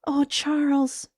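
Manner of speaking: high, slow, pleading voice; anguished, sorry appeal; remorseful cry. Acted. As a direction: cry his name full of remorse. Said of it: "all remorse".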